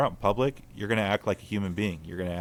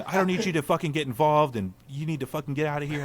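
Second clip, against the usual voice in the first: retold screaming; 7 semitones higher than usual. Man retelling a woman screaming at him, mock scream. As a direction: imitate a woman screaming, without full volume. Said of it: "she starts screaming at me".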